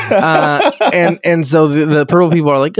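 ordinary talk, nothing marked

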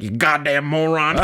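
Arthur Morgan. Acted. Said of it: "Arthur will be just like".

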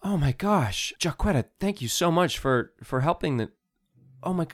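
high, excited gush; breathless, delighted friend. Acted. Malaya glad to see all three, excited. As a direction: gush thanks, then break off in high concern.

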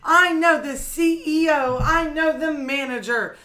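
high, loud, slowed outraged demands; raging old woman. Retold or imitated. Retelling the elderly customer raging at the cashier, mock fury. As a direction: hammer each line like an entitled customer losing it. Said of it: "she just starts raging".

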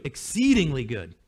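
exceedingly said low and weighty; stress on exceedingly. Shown as a demonstration. Repeats for emphasis.

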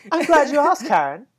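a hammed-up eager answer; bickering voices in one. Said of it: "let me do both voices".

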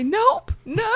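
squeaky, mock-wailing voice; hammed-up strangled high call; high strained denial. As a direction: cry it out high and drawn out. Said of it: "I say".

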